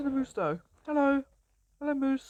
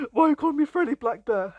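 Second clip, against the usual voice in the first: loud, higher indignant bear voice; be the bear, offended by the nickname; voicing the bear; 4 semitones higher, 7 dB louder than usual. Bear objecting to its new name, loud mock offence. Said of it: "He's like".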